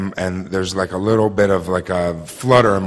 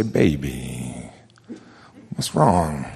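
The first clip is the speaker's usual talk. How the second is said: softer and lower, coaxing the answer out; gentle, tender question; 7 semitones lower, 5 dB quieter, much slower than usual. Retold or imitated. Husband softly asking his upset wife what's wrong. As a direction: lean in and ask it gently. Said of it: "I say".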